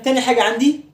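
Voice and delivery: ordinary delivery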